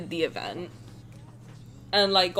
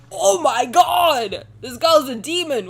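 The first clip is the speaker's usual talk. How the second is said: loud, high, drawn-out shock; hammed-up horrified outburst; mock horror; 5 semitones higher, 7 dB louder, slower than usual. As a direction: imitate someone appalled and exclaiming. Acts out horrified reaction.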